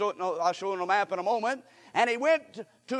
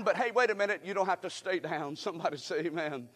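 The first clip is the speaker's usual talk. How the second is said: normal talking voice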